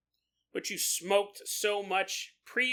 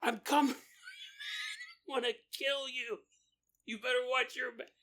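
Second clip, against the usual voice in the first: mock threat yell; 7 semitones higher than usual. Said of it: "I lean out the car".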